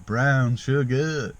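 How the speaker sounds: drawn-out, indulgent delivery; slow savouring drawl. Pretend. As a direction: savour each word slowly. Savouring the brown sugar.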